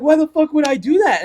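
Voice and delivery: high, loud retold exclamation; mock-appalled yell, pitch way up; horrified outburst. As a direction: imitate a friend aghast at himself. Repeats friend's horrified outburst.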